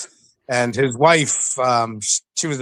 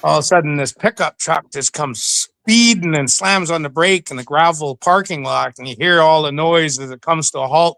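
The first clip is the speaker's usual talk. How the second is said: usual speaking voice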